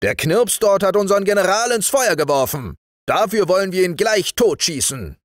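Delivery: high, forceful accusation; high-pitched military indictment; sergeant reporting. Acted. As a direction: report the crime as an outraged sergeant. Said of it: "gab der Wachtmeister zur Antwort".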